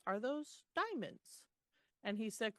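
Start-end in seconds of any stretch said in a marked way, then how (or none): 0.1-1.4 amazed. Retold or imitated. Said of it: "and she says".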